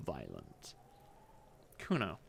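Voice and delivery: low, soft ominous voice; a dark whisper in the head; ominous inner voice. Acted. Detective's mind broods over Kuno, low and soft.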